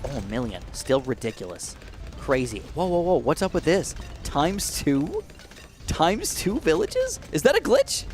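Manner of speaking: usual speaking voice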